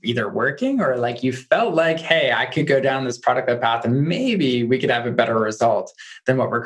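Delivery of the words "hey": ordinary delivery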